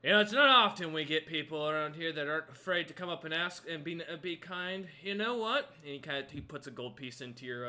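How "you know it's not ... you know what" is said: loud, booming giant, grudging respect; impressed frost giant. Acted. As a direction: boom it out, won over despite himself. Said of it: "he looks at you and goes".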